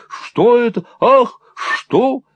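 high, loud, startled questions; agitated questioning. A narrator's reading. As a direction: ask in alarm, rushing in. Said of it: "вбегая в комнату".

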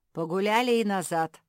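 loud, commanding guard; gruff order. A narrator's reading. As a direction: order them back firmly. Guard dog orders runaways back.

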